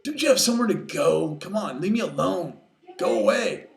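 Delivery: exasperated dismissal. Acted. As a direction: shoo the pest away loudly. Trapped Kenai sends cub away.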